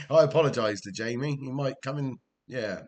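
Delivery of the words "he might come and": ordinary delivery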